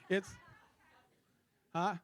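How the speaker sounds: ordinary talk, nothing marked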